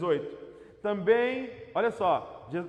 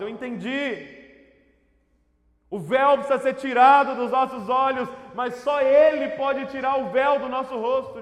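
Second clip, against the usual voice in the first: loud, driving proclamation; full-force preaching; 4 semitones higher, 9 dB louder than usual. Acted. Preacher declaring to the church who Christ is, loud and impassioned. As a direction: declare each phrase with rising conviction.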